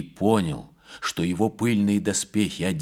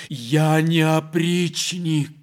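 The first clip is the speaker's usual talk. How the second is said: much higher and louder, slow protest; cried out; 9 semitones higher, 7 dB louder, much slower than usual. A narrator's reading. The prince crying out to the abbess that he is no oprichnik, loud and slow. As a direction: shout the denial, wounded. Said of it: "вскричал Серебряный".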